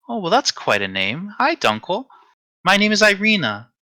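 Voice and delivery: put-on high, sweet young woman's voice; Irina. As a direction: introduce yourself politely in a high, sweet voice. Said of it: "just a soft, sweet voice".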